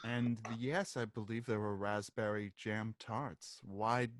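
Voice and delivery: low, quiet party guest; hushed low-voiced witness; puzzled witness. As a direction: answer softly and low, puzzled.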